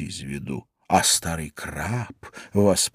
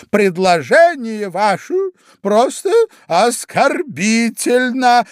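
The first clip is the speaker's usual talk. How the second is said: high, loud, drawn-out indignation; offended mouse; 6 dB louder, slower than usual. A narrator's reading. Mouse rebukes Alice for her offer, offended. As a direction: stretch out the outrage, high and loud.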